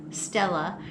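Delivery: usual speaking voice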